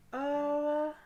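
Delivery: high, loud drawn-out oh; upset wail. Real, not acted. Player moved by the game's sad scene, upset wail.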